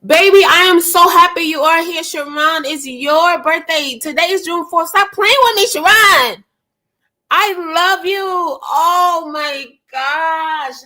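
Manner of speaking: high, bubbly birthday gush; excited gushing. Real, not acted. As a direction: keep the joy high and lively. Keeps gushing at a birthday viewer.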